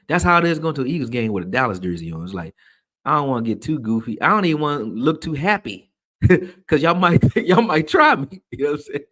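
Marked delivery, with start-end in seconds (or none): 4.2-9.0 giggling through the words, voice pushed high; laughing while talking. Real, not acted. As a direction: laugh the line out, half amused. Laughing at his own joke.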